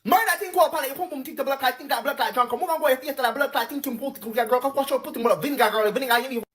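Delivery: normal talking voice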